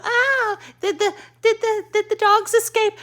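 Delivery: very high, sugary mock neighbor voice with a laugh; mock the perky jogging neighbor; chirpy neighbor voice. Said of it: "and go".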